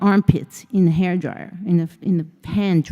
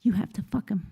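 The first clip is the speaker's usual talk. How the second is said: whispered; 8 dB quieter, faster than usual. Retold or imitated. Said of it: "I whispered to my friend".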